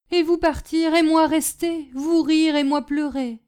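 high, loud lament in broken French; plaintive servant; forlorn, simple servant. Acted. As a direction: set their lot bitterly against one's own.

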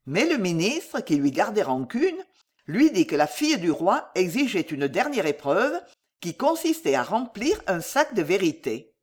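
plain reading voice

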